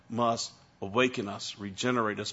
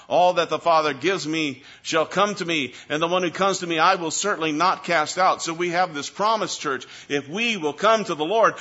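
loud, high, driving pulpit delivery; fiery preaching; 4 semitones higher, 6 dB louder than usual. Acted. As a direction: preach it to the back row. Preaching at full force.

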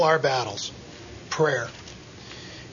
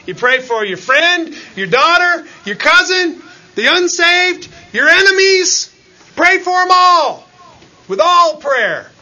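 climbing louder and higher with each item; rising preacher's shout; 10 semitones higher, 8 dB louder than usual. Acted. Preacher urging the church to pray for everyone, shouting. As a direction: build the list to a fervent shout.

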